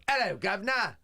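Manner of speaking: lightly mocked cockney cab driver; high cheery Cockney cabbie greeting. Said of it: "the taxi driver didn't go".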